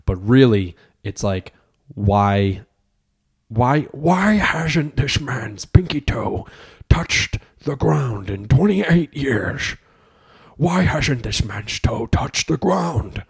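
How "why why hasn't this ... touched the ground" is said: slow, higher dramatic narrator; documentary narrator voice. Pretend. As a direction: narrate it like a true-crime doc.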